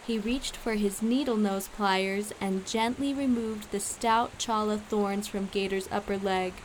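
plain reading voice

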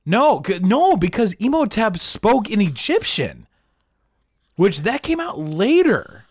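high-pitched, drawn-out impassioned protest; passionate yelling. Real, not acted. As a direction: yell a heated objection, stretching the words. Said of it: "Don't yell at me".

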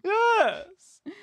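high, breathy celebrity purr, lightly voiced; a hint of a sultry star; Kim Cattrall impression. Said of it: "That's my Kim Cattrall impression".

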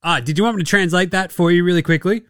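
ordinary talk, nothing marked

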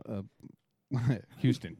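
normal talking voice

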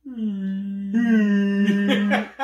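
drawn-out high hum like a whale; swelling whale call; whale noise. Pretend. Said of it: "just doing whale noise".

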